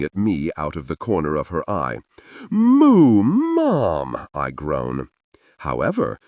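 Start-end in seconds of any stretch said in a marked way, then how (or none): 2.5-4.3 high, loud embarrassed whine; whiny protest. A narrator's reading. Son protests his mother's teasing, high embarrassed whine.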